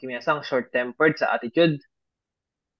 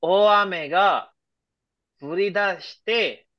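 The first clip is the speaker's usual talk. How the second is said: slow example read; 2 semitones higher, much slower than usual. Shown as a demonstration.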